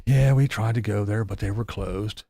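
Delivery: a lightly voiced, resigned, easy-going Tater; mock calm Tater. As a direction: shrug it off like a placid Tater. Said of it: "Now he's like".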